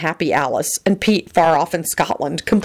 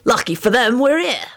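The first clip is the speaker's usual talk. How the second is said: higher-pitched self-important boast; 7 semitones higher than usual. A narrator's reading. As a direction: declare it importantly, pitch climbing. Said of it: "Sirius declared importantly".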